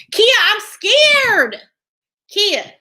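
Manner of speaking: high, loud and drawn-out "Kia, I'm scared"; mock scared whine. Telling chat viewer Kia she's too scared to watch, hammed-up whine. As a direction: ham up being scared, stretching "scared".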